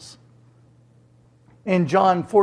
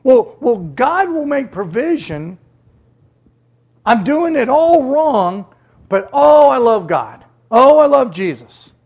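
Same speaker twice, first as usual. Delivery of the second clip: loud, higher, hammed-up pious gushing; mock gushing believer; 6 semitones higher, 9 dB louder than usual. Preacher mocking a careless churchgoer, hammed-up loud gushing. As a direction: ham up a smug believer's oh I love God.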